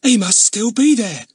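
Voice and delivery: bursting with the news; little girl eager to tell her brother; excited girl. A narrator's reading. Glad excited after spotting man.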